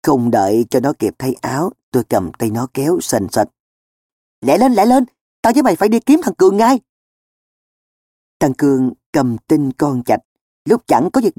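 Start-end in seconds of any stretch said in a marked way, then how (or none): 4.4-6.8 high, quick urging; raised, hasty call to get moving; urgent hurrying. A narrator's reading. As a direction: hustle a friend along in a rush. Hurrying a friend along urgently.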